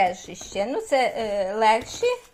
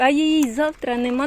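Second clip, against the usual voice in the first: ordinary talk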